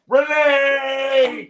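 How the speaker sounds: high, loud, stretched-out holler; mock yell. Imitating the wrestler calling his friend's name, mock yell. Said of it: "Why are you yelling?".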